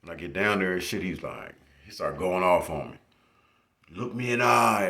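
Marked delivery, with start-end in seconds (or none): 3.9-4.9 loud, higher stern teacher command. Retold or imitated. Teacher scolding the student, loud and stern. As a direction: bark the order like an angry teacher. Teacher yelling at him, retold.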